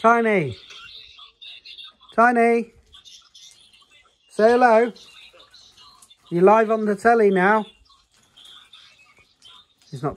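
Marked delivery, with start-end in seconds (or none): 0.0-7.6 high, louder sing-song coaxing; higher and louder playful prodding; coaxing call. Real, not acted. Coaxing child to greet fans.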